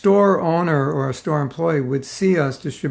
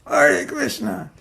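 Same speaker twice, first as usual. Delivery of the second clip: "Hare Krishna" drawn out slow and much higher, falling in disgust; 12 semitones higher, much slower than usual. Retold or imitated. Walking past a dead skunk, mock disgusted. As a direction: recoil from the smell with "Hare Krishna". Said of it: "there's a dead skunk and you go".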